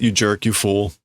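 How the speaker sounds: ordinary talk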